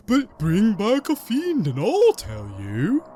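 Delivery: another head's voice. Acted. Second head bargaining in the common tongue, slow put-on voice. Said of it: "you hear another voice".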